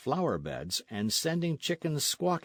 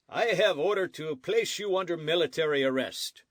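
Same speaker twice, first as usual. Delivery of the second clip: stern sergeant; 7 semitones higher, 3 dB louder than usual. A narrator's reading. Sergeant announces arrest.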